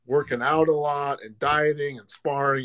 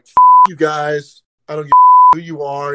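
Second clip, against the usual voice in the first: defiant shout; 11 dB louder than usual. Retold or imitated. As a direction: spit the defiance out, daring them. Defying a group of eight.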